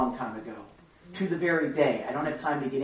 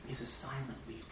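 hushed; 3 semitones lower, 15 dB quieter than usual. Real, not acted. Describing silent weeping, voice drops.

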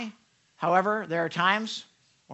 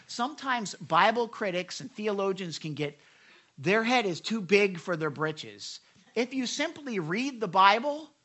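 ordinary delivery

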